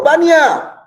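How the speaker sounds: high, loud call across the room. Real, not acted. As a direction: call the student's name to get her attention. Teacher calls on a student.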